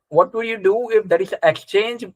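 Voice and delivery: ordinary talk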